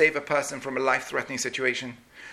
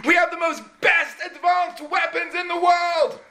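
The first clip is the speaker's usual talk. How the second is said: crowd shout; 13 semitones higher, slower than usual. Retold or imitated. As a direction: cheer like a pumped-up army. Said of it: "And all the soldiers".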